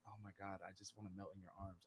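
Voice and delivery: low, soft, quick aside; swooning. Retold or imitated. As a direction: murmur it low, as if melting at the accent. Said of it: "And I'm like".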